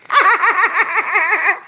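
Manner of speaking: high rattling burst of laughter; loud laughing. Real, not acted. Reader spotting the incense's name, loud laughing.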